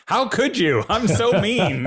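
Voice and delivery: high-pitched, pained cry of self-blame; anguished self-reproach. Retold or imitated. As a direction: voice climbs high with guilt. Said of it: "And I'm like".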